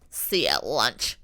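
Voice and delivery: low, slow parting remark; lightly voiced menacing sign-off; imitated villain line. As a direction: drop the pitch and draw it out. Imitating villain's line that amused her.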